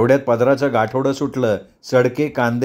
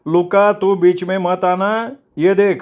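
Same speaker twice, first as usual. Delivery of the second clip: higher-pitched, slow defiant warning; defiant quarreller; 6 semitones higher, slower than usual. Acted. Injured man tells the gang boss to stay out, defiant. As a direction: warn him off in a raised, drawn-out voice. अंत्या warns Luca off in a quarrel.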